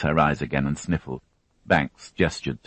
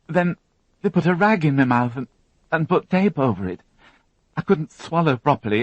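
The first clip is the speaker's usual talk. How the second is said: put-on higher, tense young woman recounting an ordeal; higher, strained witness voice; Alison, shaken young witness; 12 semitones higher, 4 dB louder than usual. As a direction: voice her as a frightened girl holding it together.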